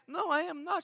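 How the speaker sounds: high-pitched imitation of an offended wife; wife's denial. Acting his wife's denial.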